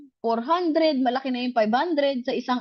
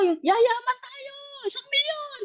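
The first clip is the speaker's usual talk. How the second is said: higher, hammed-up rally cry promising riches; mock hype speaker; 10 semitones higher, 3 dB quieter than usual. Wife mocks the seminar speaker, hammed-up higher hype. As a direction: parody the stage speaker's big promise. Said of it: "Sabi, ganun".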